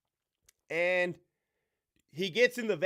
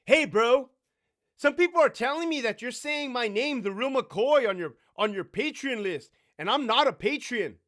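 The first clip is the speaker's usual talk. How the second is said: higher and louder mock bro voice all through; 7 semitones higher, 6 dB louder than usual.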